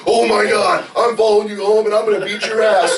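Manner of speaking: high, loud hammed-up fury; mock road rage. Mocking a driver cut off in traffic, high and loud. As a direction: play a driver losing it, laughing. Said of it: "And you're like".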